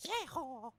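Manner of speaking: light Texan cowboy call; mock Texan yeehaw. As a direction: toss off a quick Southern yeehaw. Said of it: "a true blue Texan".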